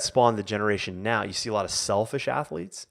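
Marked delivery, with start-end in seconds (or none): none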